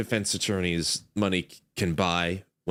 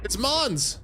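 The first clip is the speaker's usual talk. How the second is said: excited; 12 semitones higher than usual. Real, not acted. Recognizing a character excitedly.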